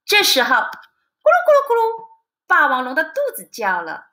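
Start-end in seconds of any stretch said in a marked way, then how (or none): 0.5-2.1 high, bubbly rumble sound; playful gurgling noise; stomach gurgle. Acted. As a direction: mimic a hungry belly growling. Narrator imitates rumbling stomach.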